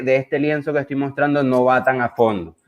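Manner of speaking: normal talking voice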